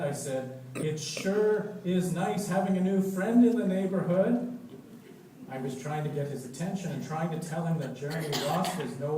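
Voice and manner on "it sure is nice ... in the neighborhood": eager hint to dad. Acted.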